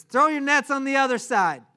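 high, loud hollered instruction; yelling from shore. Acted. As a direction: call out across the water. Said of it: "he starts yelling to them".

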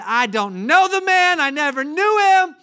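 furious high shout, words drawn out; yelling. Acted. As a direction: yell the denial at full force. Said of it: "he began cursing and yelling".

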